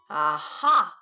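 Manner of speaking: loud sudden discovery; aha exclamation. A narrator's reading. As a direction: burst out with it. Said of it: "said the prince".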